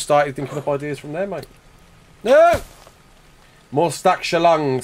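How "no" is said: high, loud cry of "no"; sudden protest. Real, not acted. Gamer reacting to the game, sudden high loud protest. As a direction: yelp out a real protest.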